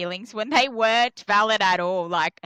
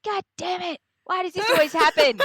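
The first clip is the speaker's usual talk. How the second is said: high exasperated whine laughing; exasperated laughing complaint; mock frustration; 12 semitones higher, 4 dB louder than usual. Retold frustrated self-quote.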